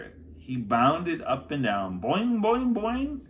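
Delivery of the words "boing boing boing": high, loud bouncy boings; springy boing sounds. A narrator's reading. Jack bounding up and down on the moon, playful springy boings.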